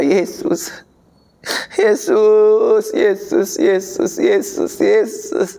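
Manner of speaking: acted plea, low and halting; tearful prayer. Believer prays alone to Tuhan about debts, tearful pleading. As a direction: pray as if through tears, ending on the repeated name. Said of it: "berdoa, netes air mata".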